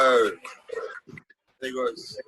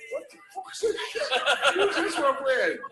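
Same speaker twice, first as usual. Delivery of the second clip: Bayern players; 11 semitones higher than usual. Retold or imitated. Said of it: "the Bayern team were going".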